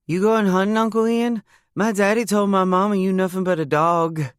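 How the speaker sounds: put-on high, cheeky little girl repeating gossip. Niece Bailey telling Uncle Ian he's a dog, in a high child voice. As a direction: play a bold small child tattling.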